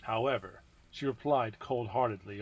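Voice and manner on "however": ordinary delivery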